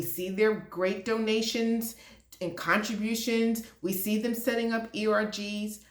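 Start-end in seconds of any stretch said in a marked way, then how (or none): none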